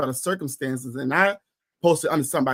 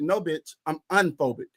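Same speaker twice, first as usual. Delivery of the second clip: ordinary talk, nothing marked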